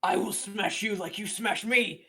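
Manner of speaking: lightly voiced monster vowing payback, punched out loud; menacing foe. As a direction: throw it out as a foe's loud threat. Game master voices a foe's threat.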